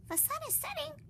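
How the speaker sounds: very high bird voice, warning; tiny bird warns the others. Acted. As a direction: chirp it as an alarm. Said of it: "warned Flitter".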